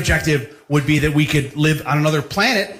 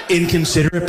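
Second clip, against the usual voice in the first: usual speaking voice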